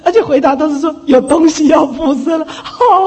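loud, through leftover laughter; laughing retelling. Real, not acted. Preacher repeats the answer about being possessed, loud and laughing. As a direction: keep laughing as you repeat the alarming reply.

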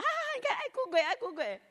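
put-on sing-song jeer at a crying kid; mocking taunt. Family teasing the crying child.

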